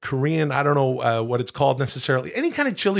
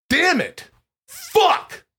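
sharp, much higher cry of pain; sudden yelp; 13 semitones higher, much slower than usual. Real, not acted. Host suddenly yelps out mid-recipe, high startled cry. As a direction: yelp like you just got hurt.

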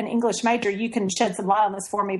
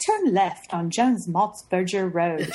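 slow, lightly put-on British sat-nav voice; British Siri voice; 5 semitones lower, much slower than usual. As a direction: mimic a British Siri giving directions, lightly. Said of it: "she's like".

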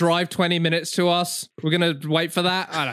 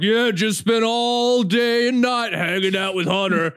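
gloating bro voice; 6 semitones higher than usual. Retold or imitated. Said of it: "I saw donut operators like".

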